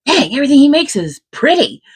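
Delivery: high, loud mock-admiring voice; a light voicing of Fulgrim, pitched well up; imitating Fulgrim. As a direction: gush like a dazzled brother. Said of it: "Fulgrim's like".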